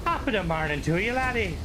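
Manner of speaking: put-on stock Irish accent. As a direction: play the cliché Irish greeting in an Irish brogue. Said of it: "With Ireland".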